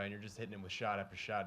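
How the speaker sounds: ordinary talk, nothing marked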